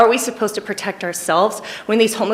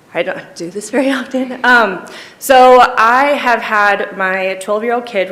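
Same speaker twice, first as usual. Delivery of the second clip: upset, struggling; 3 semitones higher, 5 dB louder than usual. Real, not acted. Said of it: "sorry, this is hard".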